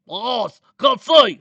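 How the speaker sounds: high, very loud pained yell; screamed in pain. A narrator's reading. Slice and Dice with dust in his eyes, pained scream. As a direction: shriek it out as if blinded. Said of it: "Slice and Dice screamed in pain".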